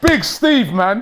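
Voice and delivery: big, loud, high cheer; excited cheer. Real, not acted. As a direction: hail him like a hero. Cheering a player.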